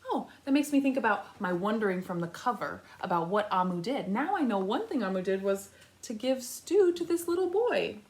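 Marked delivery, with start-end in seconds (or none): none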